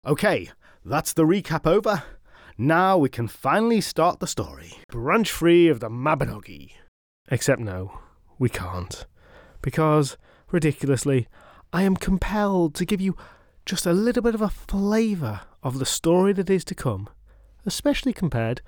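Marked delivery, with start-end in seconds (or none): none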